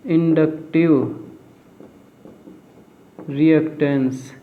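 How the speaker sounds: drawn out word by word, long pause between; slow dictation. Real, not acted. Teacher giving students a new term to note, slow dictation. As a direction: dictate it slowly for note-takers.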